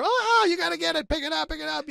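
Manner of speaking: handcuffed actor urging his co-star to pick it up; urgent excitement. Retold or imitated. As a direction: rush it, begging him to grab it. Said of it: "so we were like".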